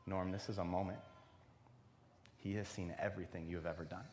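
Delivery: low, soft, solemn conviction with long pauses; quiet boldness. Retold or imitated. Young woman boldly telling the man he is loved, solemn. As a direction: press each phrase home quietly and deliberately. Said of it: "in boldness and power".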